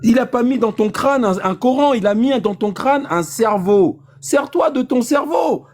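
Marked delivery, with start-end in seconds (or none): 4.2-5.7 high, slow, drawn-out urging; real exasperation, pitched high and stretched; exasperated exhortation. Real, not acted. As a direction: raise the pitch and drag out the order. Heated argument, exhorting the other.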